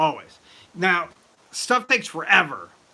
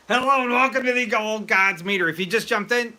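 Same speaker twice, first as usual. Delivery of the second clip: host welcome; 6 semitones higher, 5 dB louder than usual. Real, not acted. Host greets stream viewers.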